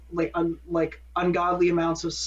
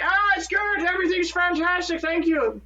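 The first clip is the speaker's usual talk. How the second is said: mock Irish accent; 10 semitones higher than usual. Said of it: "not that they talk in Irish accents".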